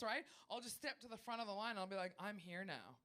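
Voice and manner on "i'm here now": low, soft announcement; understated, low-pitched self-assurance; cool entitlement. Acted. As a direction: state it quietly, as a given. Acted queue-jumper announcing herself calmly.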